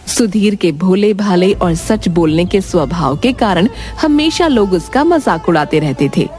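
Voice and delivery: ordinary delivery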